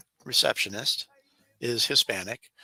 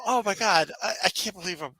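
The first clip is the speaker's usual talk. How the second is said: excited disbelief; 6 semitones higher than usual. Real, not acted. Retelling disbelief while laughing.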